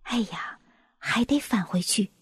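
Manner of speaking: hushed, deflated murmur; soft dismay. A narrator's reading. Friends realising they must go back, soft dismay. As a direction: sigh it softly in disappointment. Friends disappointed, scent gone again.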